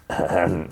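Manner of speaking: mock impatient throat clear; small pointed throat clear. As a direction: clear your throat to prompt someone. Acting her waiting for him.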